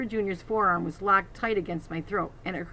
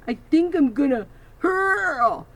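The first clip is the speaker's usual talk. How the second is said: slow, high, crying moan; bully near tears, slow and louder; queasy whimper; 9 semitones higher, 9 dB louder, slower than usual. Acted. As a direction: whine like he'll be sick. Bull in pain, ear bitten.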